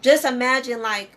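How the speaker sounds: usual speaking voice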